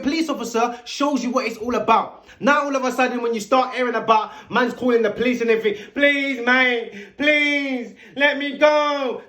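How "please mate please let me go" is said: whiny pleading mimic, higher and slow; mock the boy begging to be let go; hammed-up pleading voice. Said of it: "Now you're crying".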